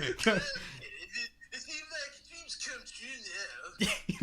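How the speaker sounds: high, loud, slow goofy dad; strained, dragged-out father impression, laughing through it; stroke-like dad voice. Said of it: "Why does he sound like that?".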